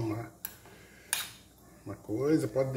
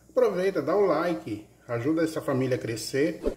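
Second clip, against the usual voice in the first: louder, upbeat subscribe pitch; channel plug; 3 semitones higher, 7 dB louder than usual. Acted. Cook urges viewers to subscribe and like, upbeat louder plug.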